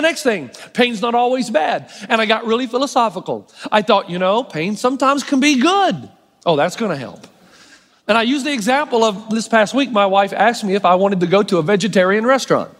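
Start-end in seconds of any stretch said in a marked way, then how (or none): 6.4-7.3 dry, much lower sarcastic aside; deadpan mockery of his own point; low, dry eye-roll of a line. Mocking his own advice.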